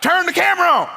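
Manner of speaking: very high, loud preaching cry; climactic shout. Acted. Preacher crying out to the congregation, very high and loud. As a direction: peak of the sermon, shout it.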